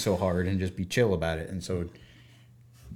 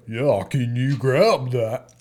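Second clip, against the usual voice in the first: drawn-out English kid's attempt at American; mock American drawl through an English accent; goofy fake American accent; 3 dB louder, much slower than usual. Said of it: "trying to do my American accent and his really goofy English accent".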